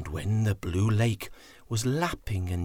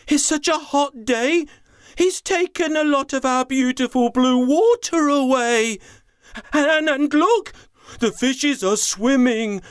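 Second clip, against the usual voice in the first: much higher, louder, alarmed cries; 12 semitones higher, 4 dB louder than usual. Acted. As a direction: cry out in dismay at each new discovery. Elephant alarmed at the lake.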